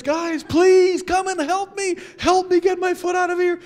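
yelling for help. Retold or imitated. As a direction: shout to friends in panic. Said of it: "I yelled out to my friends".

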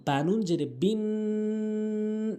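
বিন held through the nose; slow spelling with a nasal hum; nasal ghunnah. Shown as a demonstration. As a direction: ghunnah demo. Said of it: "এখানে কিন্তু গুন্না রয়েছে".

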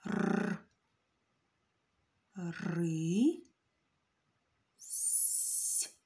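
р, ри, с sounded separately with pauses, с hissed voiceless; sounding out. Shown as a demonstration. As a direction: break рись into sounds. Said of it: "І слово рись".

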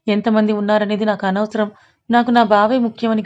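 ordinary delivery, nothing marked

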